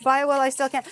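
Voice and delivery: mock-manic buy-it-all cry; excited. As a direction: urge yourself on greedily. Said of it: "so I'm like".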